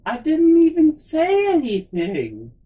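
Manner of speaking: high, loud, drawn-out wail of protest; mock protest. Lady friend to back seat after being screamed at, wailed protest. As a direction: stretch it out in injured disbelief.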